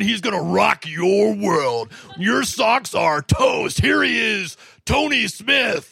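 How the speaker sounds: slow, drawn-out, shouted announcer build-up; mock a pumped-up show intro announcer; hype announcer. Intro announcer hyping up the host, slow shouted hype. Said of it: "the deep voice guy and the high energy guy".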